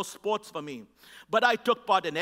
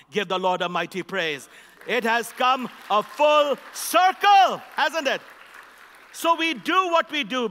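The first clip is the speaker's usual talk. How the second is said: much louder, higher and slow, rousing the crowd; full-force preaching; 4 semitones higher, 6 dB louder than usual. Acted. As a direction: call out to the congregation, stretching every phrase.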